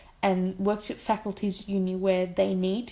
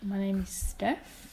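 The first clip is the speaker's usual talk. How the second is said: slow, softer, deliberate reply; firm deadpan; 3 semitones lower, 9 dB quieter, slower than usual. Retold or imitated. Refusing the surveyor her surname, slow deadpan. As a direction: state it slowly and flatly.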